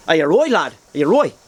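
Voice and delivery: Dublin lilt, T dropped on "right"; quick demo of a Dublin accent. Shown as a demonstration. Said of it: "the Dublin accent, that's where they drop their T".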